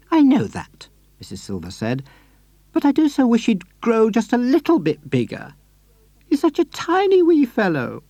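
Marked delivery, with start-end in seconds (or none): 0.1-0.9 put-on high, sweet old lady voice; Mrs Silver voice. A narrator's reading. 2.7-8.0 put-on high, sweet old lady voice; Mrs Silver voice. A narrator's reading.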